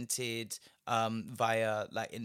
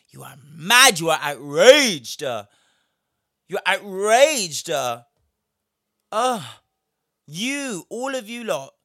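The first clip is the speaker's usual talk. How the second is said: loud, slow furious shout; shouting rant; 6 semitones higher, 13 dB louder than usual. Real, not acted. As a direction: yell it at them. Angry rant peak.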